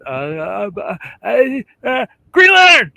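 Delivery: stammer, climbing to a high, loud burst; flustered blurting. Acted. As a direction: fumble for words, then shout the answer out. Mocks own flustered answer to artist.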